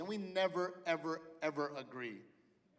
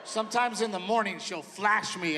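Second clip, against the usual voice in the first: usual speaking voice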